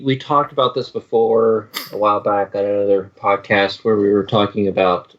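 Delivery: ordinary talk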